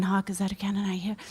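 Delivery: quick, soft run-through; in his head. Acted. Actor about to step on stage rehearsing silently, softly. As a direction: say it softly and rapidly to yourself. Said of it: "saying it in his head".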